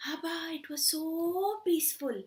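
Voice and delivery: ordinary delivery, nothing marked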